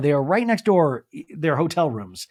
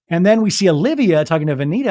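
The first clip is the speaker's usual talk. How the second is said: ordinary talk, nothing marked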